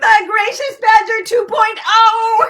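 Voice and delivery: loud, high, slow reveal shout; thrilled fanfare shout; excited announcement. Real, not acted. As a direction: shout it out like a grand unveiling. Big baby reveal announcement.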